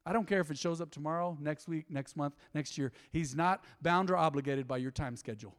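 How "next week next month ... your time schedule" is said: ordinary delivery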